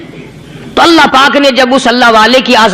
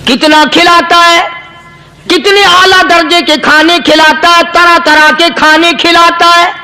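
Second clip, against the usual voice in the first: higher, fervent sermon voice; impassioned preacher; preaching; 4 semitones higher than usual. Acted. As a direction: drive the point home to the crowd.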